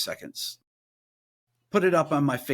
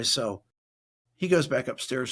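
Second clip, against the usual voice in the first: ordinary talk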